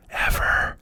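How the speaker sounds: a hushed "Ever" to drive the point home; whispered ever. Shown as a demonstration. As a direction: whisper "Ever" with no voice, as a final emphasis.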